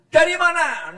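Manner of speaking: "Dari mana?" asked high, falling on "mana"; demanding wife's high question; mock nagging wife asking "Dari mana?" up high; high-pitched question. Shown as a demonstration. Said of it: "Nadanya tinggi".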